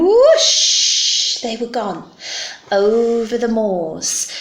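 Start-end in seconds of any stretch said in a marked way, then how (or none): 0.0-1.4 long, rising loud whoosh; whooshing take-off. Acted. Broom takes off with its riders, loud drawn-out whoosh. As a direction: draw the word out like rushing wind. Narrator imitates broom taking off.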